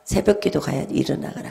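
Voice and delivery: soft waking call. Retold or imitated. The Lord softly waking her for dawn prayer, hushed. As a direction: gently rouse a sleeper. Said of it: "제가 주님이 깨워요".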